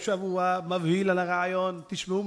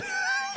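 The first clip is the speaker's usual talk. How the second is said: high shriek of laughter. Real, not acted.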